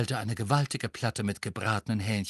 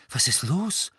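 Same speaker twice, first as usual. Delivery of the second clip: higher, startled question; 5 semitones higher than usual. A narrator's reading. Hermine seeing her friend in pain, startled. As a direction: ask it with a jolt of alarm. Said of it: "fragte Hermine erschrocken".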